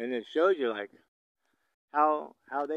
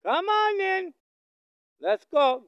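very high, loud call to the bull; 9 dB louder, slower than usual. Real, not acted. Rancher calling the bull from afar, high and loud. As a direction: sing it out high to draw him over.

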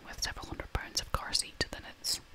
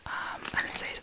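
ordinary talk

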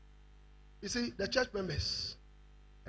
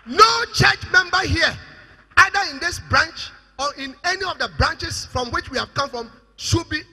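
loud, emphatic preacher's command; forceful pulpit shout; full-force preaching; 6 semitones higher, 12 dB louder than usual. Real, not acted. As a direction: thunder the warning to the congregation.

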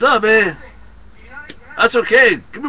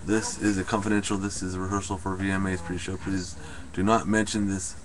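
much softer confidential murmur, lower; hushed aside; 11 semitones lower, 11 dB quieter than usual. Acted. Telling the audience it's a rehearsal, hushed and secretive. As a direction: drop to a secretive undertone.

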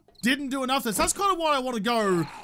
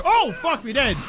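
startled swear; 4 semitones higher, 5 dB louder than usual. Real, not acted. Startled by a zombie.

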